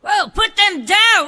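loud, slow recoil; startled shout. Acted. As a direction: shout put them down in disgust.

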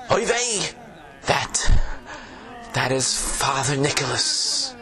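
put-on old tavern keeper, Yiddish accent; fretful innkeeper with a Yiddish lilt.

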